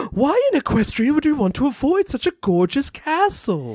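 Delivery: dramatic gasp. Acted. Rarity shocked at the idea.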